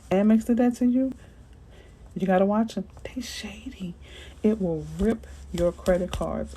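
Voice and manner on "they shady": whispered aside. Real, not acted. Warning viewers about card companies, a whispered aside. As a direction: drop to a whisper, confiding. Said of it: "whispered aside about banks".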